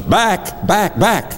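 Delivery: high-pitched mock warding cry; B-movie vampire repeller. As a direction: ward off a vampire, hammed up. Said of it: "Have you ever seen a B-grade movie".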